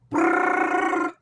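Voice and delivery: high rolled brrr trill; rolled r exercise. Shown as a demonstration. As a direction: trill the tongue, machine gun style. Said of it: "I roll my tongue".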